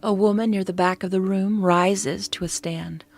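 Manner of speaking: ordinary delivery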